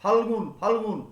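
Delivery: Phalgun with ফাল্ stressed; stressed first syllable. Shown as a demonstration. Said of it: "লয়ের উচ্চারণটা কি হয়ে গেল". (ফাল্-এ শ্বাসাঘাত দিয়ে ফাল্গুন)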